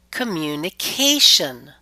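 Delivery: "communication" with CA punched and lengthened; stress before suffix. Shown as a demonstration. Teacher shows the rule, "communication" stressed on CA. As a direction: stretch and lift CA in "communication". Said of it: "we stress the syllable immediately preceding it".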